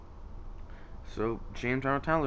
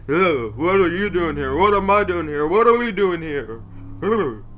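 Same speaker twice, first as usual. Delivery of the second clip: loud, higher, exaggerated character impression; hammed-up bewildered outburst, much louder; Doug Walker; 5 semitones higher, 10 dB louder than usual. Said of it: "did his future stuff, he was like".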